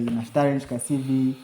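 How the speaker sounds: normal talking voice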